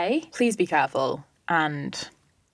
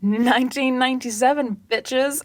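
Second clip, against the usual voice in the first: tipsy shout; 5 semitones higher, 3 dB louder than usual. Retold or imitated. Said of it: "I was a little bit tipsy".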